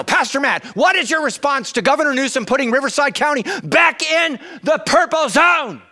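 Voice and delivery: loud, high mocking voice; mock heckler shouting; mock shouted question. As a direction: shout the question like a pushy crowd. Quoting people asking him.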